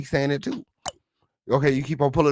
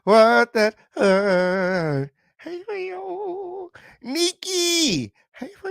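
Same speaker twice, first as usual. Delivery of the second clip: stretched falsetto; 12 semitones higher, much slower than usual. Acted. Host teasing viewers in stretched falsetto. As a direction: float it up high and slow.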